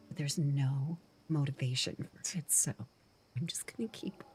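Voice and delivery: soft breathy whisper throughout. Shown as a demonstration. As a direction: whisper it like a secret. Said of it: "I like to whisper".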